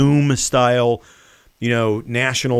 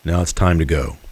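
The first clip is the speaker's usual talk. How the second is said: mock gentle farewell; 5 semitones lower, 4 dB louder than usual. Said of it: "gently remove him".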